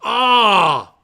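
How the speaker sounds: loud, high burst of joy; excited shout. Real, not acted. Fan hearing about a new edition, shouting for joy. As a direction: yell with delight at the news. Hears Earthling will do Serafina.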